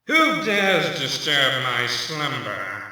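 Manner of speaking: low, slow, rumbling undead king; ancient rasping undead king. Acted. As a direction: wake from the grave slowly and deep. Summoned undead awakening, deeper take.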